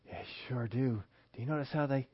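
conspiratorial whisper. Acted. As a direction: lean in and whisper the gossip. Gossip lowers voice about neighbor.

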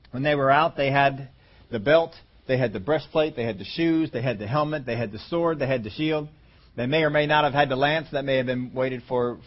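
ordinary delivery

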